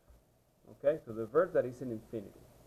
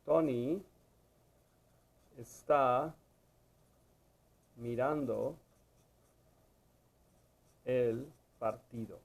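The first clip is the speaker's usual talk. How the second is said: one word at a time, long pauses; slow dictation; slower than usual. Shown as a demonstration. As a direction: spell out the sentence word by word. Dictates an example while writing it.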